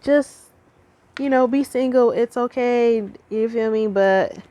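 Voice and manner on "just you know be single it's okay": lightly voiced gentle inner voice, higher. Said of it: "that little voice that was like".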